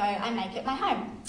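ordinary talk

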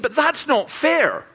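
high, drawn-out protest; mimicked aggrieved objector; indignant objection. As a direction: cry foul, stretching the words. Scientist jumps up objecting.

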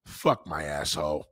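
put-on a deadpan older lover. A comic imitating an older woman in bed, understated. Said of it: "She be like".

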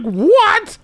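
very high-pitched disbelieving yelp; hammed-up childish astonishment; mock shock. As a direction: squeal it like a stunned kid. Acting shocked child in his story.

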